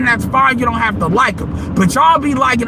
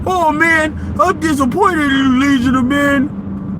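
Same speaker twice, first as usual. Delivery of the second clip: a whiny fan's voice, slower; mock the fans' fake disappointment; lightly put-on sulky fan voice; 2 semitones higher, slower than usual. Mocks disappointed listeners.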